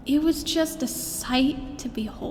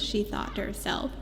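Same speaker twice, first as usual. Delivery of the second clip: whispering; 4 semitones lower, 10 dB quieter than usual. A narrator's reading. Narrator aside.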